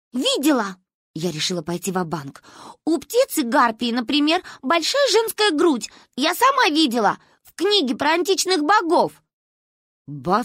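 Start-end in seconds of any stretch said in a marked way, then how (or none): 0.1-0.8 put-on high, short child's retort; childish, emphatic comeback; defiant little girl. A narrator's reading. Child defiantly answers grandmother. 2.9-9.1 put-on high child voice, rising in pitch; high, earnest childish insistence; insistent little girl. A narrator's reading. Child bluffs, arguing with grandmother.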